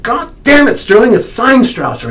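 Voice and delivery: high, loud exasperated insisting; angry shout. Retold or imitated. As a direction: shout him down in frustration. Retold argument, insisting on the signature.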